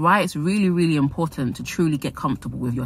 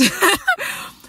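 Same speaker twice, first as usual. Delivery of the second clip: laugh. Real, not acted. After mocking fans.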